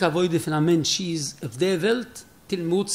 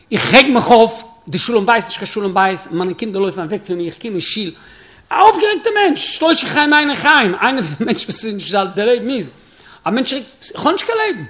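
worked-up complaint; 3 semitones higher, 5 dB louder than usual. Acted. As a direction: pour out your troubles to the rebbe, worked up. Chassid complains of his temper. (אויפגערעגטע טענה)

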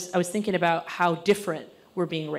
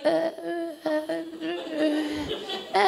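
high, soft repeated uh noises; stammered wordless grunts, played up; odd stalling noises; 9 semitones higher, 5 dB quieter than usual. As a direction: make strange strained noises instead of answering. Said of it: "I just started making noises".

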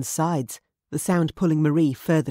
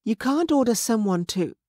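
drowsy woman; 7 semitones higher than usual. A narrator's reading.

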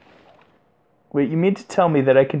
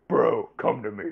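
softer mock call, falling at the end; 6 semitones lower, 6 dB quieter than usual. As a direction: drop it down and call again softly. Repeats the call more quietly.

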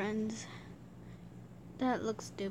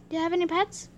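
ordinary delivery

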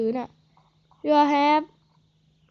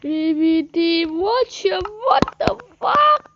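slow, rising cry of shock, much higher; stunned drawn-out yell; 5 semitones higher, 5 dB louder, much slower than usual. Real, not acted. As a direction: stretch "what the fuck" into a long, high, loud shriek of disbelief. Shocked by in-game event.

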